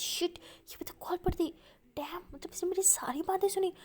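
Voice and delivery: put-on girl realizes the call was live, panicked whisper. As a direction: whisper it in alarm, afraid of being caught. Panicked realization she was overheard.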